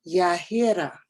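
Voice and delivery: "Yahira" with H and tapped R, falling at the end; modeled name attempt. Shown as a demonstration. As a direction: try "Yahira" deliberately with a tapped R. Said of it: "let me model for you".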